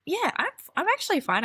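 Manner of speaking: high airy dismissal; carefree mock denial; breezy mock fine. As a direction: brush it off lightly. Acted breezy self-quote.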